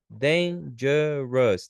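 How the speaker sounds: "Dangerous" split slowly into three separate beats; syllable by syllable. Shown as a demonstration. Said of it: "três sílabas".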